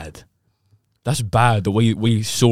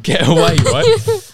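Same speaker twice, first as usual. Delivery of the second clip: shout; 7 dB louder, much slower than usual. Retold or imitated. Retold shout with a cry.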